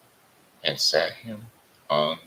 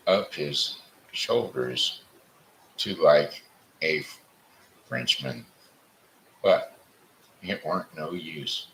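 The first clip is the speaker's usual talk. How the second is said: ordinary delivery, nothing marked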